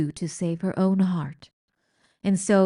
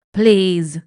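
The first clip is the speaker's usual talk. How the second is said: much louder pleading shout; 11 dB louder than usual. A narrator's reading. Old rat urging the mouse to kill. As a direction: yell it across the dungeon. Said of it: "shouted Botticelli".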